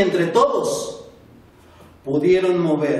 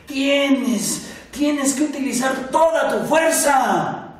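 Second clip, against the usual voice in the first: shouting; 7 semitones higher, slower than usual. A narrator's reading. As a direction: yell it from across the yard. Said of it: "le gritó".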